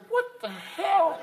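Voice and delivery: high, long drawn-out disbelief; lion's drawn-out incredulous cry; startled lion. Acted. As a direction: stretch it out in a high, stunned voice. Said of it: "This lion says".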